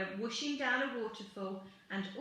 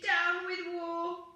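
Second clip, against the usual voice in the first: high, slow, loud protest chant; drawn-out high rebel cry; hooting; 7 semitones higher, 5 dB louder, slower than usual. A narrator's reading. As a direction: hoot it out like a small dinosaur. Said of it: "and he hooted".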